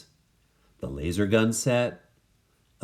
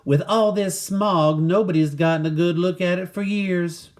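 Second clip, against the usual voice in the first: cowgirl voice; 9 semitones higher, 4 dB louder than usual. Acted. Cowgirl explains the smog.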